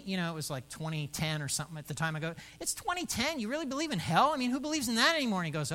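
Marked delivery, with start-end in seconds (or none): 2.6-5.4 high, fast mock incredulity; mock disbelief. Pastor teasing Dennis about hell, in high, quick mock disbelief. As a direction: play at being shocked anyone believes in hell.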